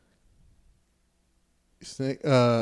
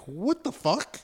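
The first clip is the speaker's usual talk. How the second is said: a sudden high, incredulous "What the fuck?"; baffled outburst; 8 semitones higher, 5 dB quieter, slower than usual. Retold or imitated. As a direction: react like you can't believe what just happened. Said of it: "I'm like".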